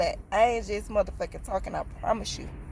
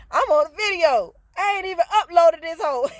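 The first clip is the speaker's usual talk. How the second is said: laughing; 6 semitones higher, 6 dB louder than usual. Real, not acted. Laughing at herself on video.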